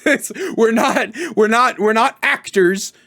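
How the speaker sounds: normal talking voice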